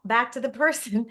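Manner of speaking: ordinary delivery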